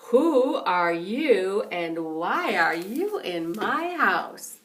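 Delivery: deep, slow bear voice; bears asking. Retold or imitated. Bears ask Goldilocks why she is in their house, deep and slow. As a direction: rumble it like big puzzled bears.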